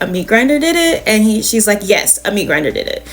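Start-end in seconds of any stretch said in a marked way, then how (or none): 0.0-1.0 higher, quick disbelieving question; incredulous question. Retold or imitated. Girls questioning their mother's story, incredulous. 1.8-3.1 lower, flat falling statement; firm insistence. Retold or imitated. Mother insisting to the girls, firm and final.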